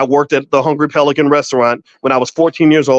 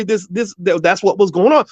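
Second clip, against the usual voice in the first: normal talking voice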